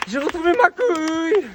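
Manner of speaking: slow, loud, high triumphant yell; loud relieved shout. Real, not acted. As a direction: bellow it out in relief. Relief after winning duel.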